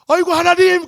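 high, loud shriek of alarm; mother crying out as her daughter falls; panicked cry. Retold or imitated. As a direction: scream it as the child falls.

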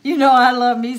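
loud, high, exuberant; hearty delighted exclamation; gleeful. Real, not acted. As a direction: belt it out fresh from laughing. Delighted at sugar-free candy, laughing.

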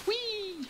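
tiny, very high squeal, softly drawn out; soft high-pitched glee; playful whee. Pretend. Player sends things flying, soft high whee. Cheers while playing.

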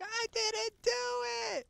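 high, shouted denial; protesting kid. Retold or imitated. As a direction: yell it like a guilty kid. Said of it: "And it's like".

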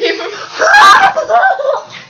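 high, loud burst of laughter. Real, not acted. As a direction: crack up loudly. Laughs at own joke.

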